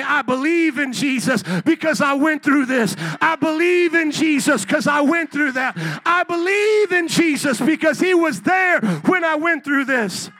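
loud high preaching shout, building; shouted testimony. Retold or imitated. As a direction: belt it out like a revival testimony. Believers' testimony shouted back, preaching.

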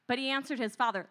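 ordinary talk, nothing marked

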